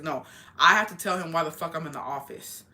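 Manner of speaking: ordinary talk, nothing marked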